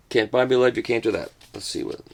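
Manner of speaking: ordinary delivery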